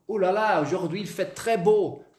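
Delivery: "Ouh là là, aujourd'hui il fait très beau" exclaimed, falling at the end; exclamatory intonation. Shown as a demonstration. Teacher carries the exclamation into French, exclamatory. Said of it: "ponto de exclamação".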